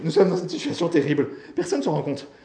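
loud, high, distressed appeal; voice near cracking. A narrator's reading. Bailey in a terrible situation, distressed appeal. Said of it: "Sa voix craqua soudain".

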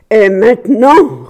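loud, slow, drawn-out roar. A narrator's reading. Husband before the open door of skeletons, roaring. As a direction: roar it with menace. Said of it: "rugit-il".

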